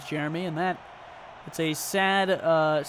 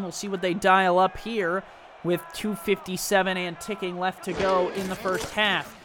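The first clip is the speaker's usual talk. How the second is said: usual speaking voice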